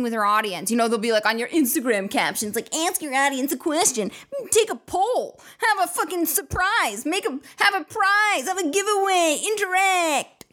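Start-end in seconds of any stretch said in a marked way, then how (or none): 2.7-10.5 high, hammed-up influencer pitch; mock marketing guru. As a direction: chirp the advice like a caption coach. Mocking social media advice.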